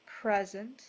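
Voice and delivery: measured, soothing delivery, each phrase given room; guided meditation. Acted. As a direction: guide them gently into peace, unhurried.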